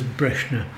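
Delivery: Breshna, first vowel an e, then sh; older ones' pronunciation. Shown as a demonstration. Storyteller ends his list with the elders' Breshna, e and sh. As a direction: say Brosna as "BRESH-na". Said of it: "from the older ones".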